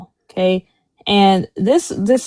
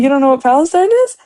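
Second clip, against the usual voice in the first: fast, higher and louder incredulous question; 6 semitones higher, 4 dB louder, faster than usual. Retold or imitated. Retelling his shocked question to the vendor, fast and incredulous. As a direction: fire it off in disbelief. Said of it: "I was like".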